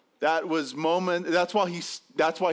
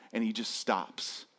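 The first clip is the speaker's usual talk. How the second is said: ordinary delivery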